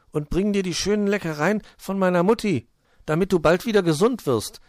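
hohe, laute, eifrige Kinderstimme; tröstender kleiner Igel. Acted. Voices Borstel cheering up friend. (eifrig aufmunternder kleiner Igel)

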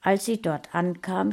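ordinary delivery, nothing marked

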